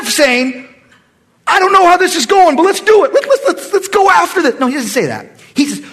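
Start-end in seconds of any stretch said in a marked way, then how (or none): 1.5-4.6 much higher and louder, rushing to go; rally the troops, stumbling with eagerness; eager charge. Retold or imitated. Preacher imagines Saul urging the army into battle, eager. What Saul should have said.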